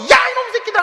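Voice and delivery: high-pitched acted scolding yell; irritated outburst. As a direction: snap at the kids in mock fury. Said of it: "신경질 확 내고 나면요". (신경질 확 내며 지르는 흉내)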